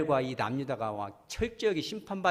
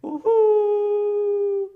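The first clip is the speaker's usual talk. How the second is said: very long, high, loud wail; wailing howl; 12 dB louder, much slower than usual. Shown as a demonstration. Preacher leading the congregation in a long wailing howl. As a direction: cry out like a mourning jackal. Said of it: "여러분도 한번 해보죠".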